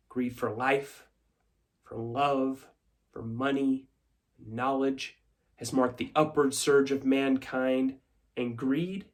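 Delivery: soft, measured list with pauses; quiet credo. Acted. Shareholder extols greed to stockholders, quiet and deliberate.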